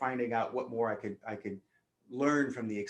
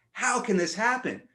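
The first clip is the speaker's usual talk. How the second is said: much higher and louder, indignant demand; furious outburst; 11 semitones higher, 7 dB louder than usual. Retold or imitated. Speaker raging after being possessed, loud angry question. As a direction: blurt the question in outrage. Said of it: "I was pissed".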